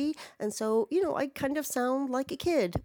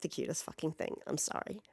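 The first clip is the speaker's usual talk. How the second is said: hushed; 6 semitones lower, 12 dB quieter, faster than usual. Real, not acted. Aww at cat.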